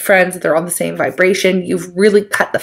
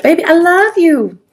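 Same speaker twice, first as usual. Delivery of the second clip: mock lovesick ex; 8 semitones higher than usual. Voicing ex coming back.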